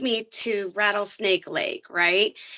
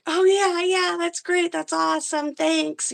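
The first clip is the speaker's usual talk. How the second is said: a lightly played, breezy higher "oh yeah, that's great"; retold casual reply; 8 semitones higher than usual. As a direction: imitate your own offhand, upbeat answer, pitched up. Retells her own reaction.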